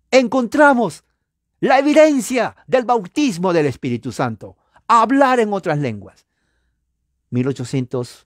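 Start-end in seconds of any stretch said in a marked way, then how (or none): 0.1-6.2 loud, high pulpit proclamation; ringing declaration from the pulpit; proclaiming. Real, not acted. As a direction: announce it like the big reveal. Preacher proclaims the key evidence.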